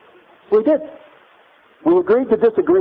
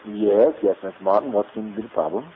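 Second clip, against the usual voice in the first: professor's reply; 9 semitones lower, 5 dB quieter than usual. Retold or imitated. Professor answering a student in class.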